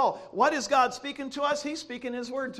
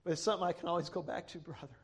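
low, softened preaching voice; solemn testimony; 5 semitones lower, 6 dB quieter than usual. Real, not acted. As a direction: drop low and quiet, confiding a deep conviction. Hushed, solemn testimony to congregation.

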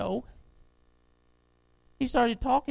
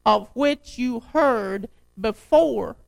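slow, emphatic pulpit reading; scripture reading; 5 dB louder, slower than usual. Acted. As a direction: proclaim the verse, landing hard on key words. Resumes reading the letter aloud.

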